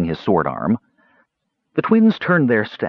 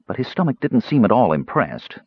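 usual speaking voice

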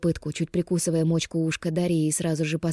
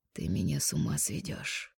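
much lower, softer rasping male growl; husky low growl; 11 semitones lower, 10 dB quieter than usual. Acted. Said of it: "сиплым низким голосом прорычал".

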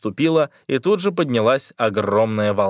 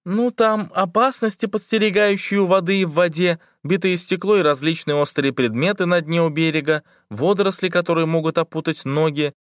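put-on nervous girl voice; 6 semitones higher than usual. A narrator's reading. Liza lists dangers nervously.

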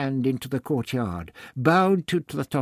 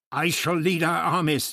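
stubborn; 6 semitones higher, 3 dB louder than usual. Acted. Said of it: "Ixter replied stubbornly".

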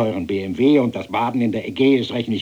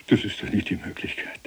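gehauchtes empörtes Staunen; leise, kopfschüttelnd flüstern; ungläubig geflüstert; 6 dB quieter than usual. Acted. Empörtes Staunen über das Gehalt. (fassungsloses Flüstern)

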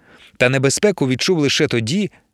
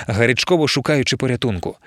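ordinary delivery, nothing marked

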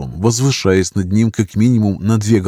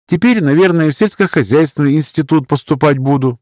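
put-on higher-pitched, earnest girl's voice; chatty young farm girl; 8 semitones higher, 3 dB louder than usual. A narrator's reading. Girl telling companion about farm life, chatty and earnest. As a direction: voice a girl fond of her cows.

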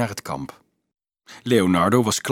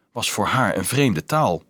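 ordinary delivery

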